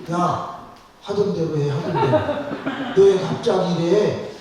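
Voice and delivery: high, loud, half-laughing alarm; uneasy husband. Retold or imitated. Husband begs his wife to act normal again, high uneasy alarm. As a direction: plead with rising unease.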